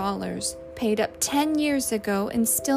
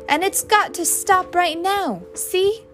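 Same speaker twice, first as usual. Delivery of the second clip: higher, louder, insistent ordering; angry ultimatum; 6 semitones higher, 7 dB louder than usual. A narrator's reading. Husband ordering his wife to fix the marriage, heated. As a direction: lay down the law, punching each clause.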